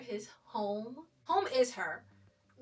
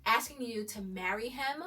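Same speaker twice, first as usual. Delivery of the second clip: usual speaking voice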